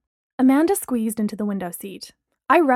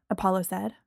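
ordinary delivery, nothing marked

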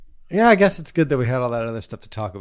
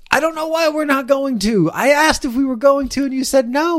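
bickering; 14 semitones higher, 6 dB louder than usual. Real, not acted. Mock quarrel about snacks.

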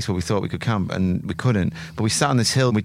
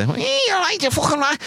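very high-pitched nasal Liverpool voice; high modern Scouse accent. Shown as a demonstration. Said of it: "the Scouse accent went high".

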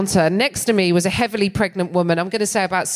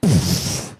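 low puffed burst like combusting; explosion noise. Pretend. Comic mimicking herself combusting, a low puffed burst. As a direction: blow out a sudden pfft.

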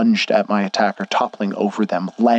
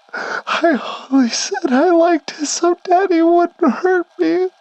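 high, slow, frightened confession; trembling plea; much slower than usual. Acted. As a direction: tremble through it like a terrified child. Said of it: "his voice trembling".

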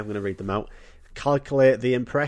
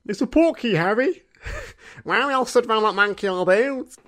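high put-on boyish voice; Harry Potter impression; 10 semitones higher than usual. Mocking young Harry in the film's opening scene, high put-on voice. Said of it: "Harry Potter at start of Goblet of Fire".

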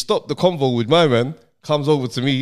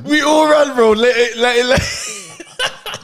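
laughing; 9 semitones higher, slower than usual. Real, not acted. Laughing while retelling the fight.

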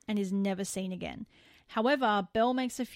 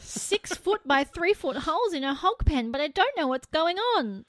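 higher-pitched mock-puzzled voice, building louder; mock cluelessness; 7 semitones higher, 4 dB louder than usual. Host mimicking townsfolk watching men vanish, mock clueless. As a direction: act out oblivious onlookers, pitched up and incredulous. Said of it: "They're like".

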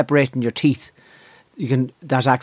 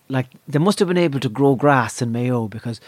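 ordinary talk, nothing marked

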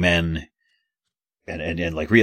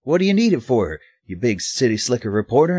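mock Oklahoman accent; 6 semitones higher than usual. Reporter mocking the Oklahoman painter, exaggerated Southern accent. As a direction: ham up a Southern Oklahoman twang, much higher. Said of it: "do my impression of him going".

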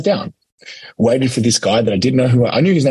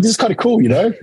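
gleeful; 9 semitones higher than usual. Retold or imitated. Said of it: "I'm like".

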